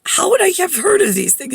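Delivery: high-pitched incredulous question; disbelieving, voice lifted high; incredulous retort. Retold or imitated. Retelling her baffled reply.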